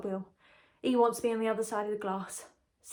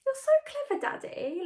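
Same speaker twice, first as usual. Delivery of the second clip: put-on cutesy baby voice. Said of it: "I says to him".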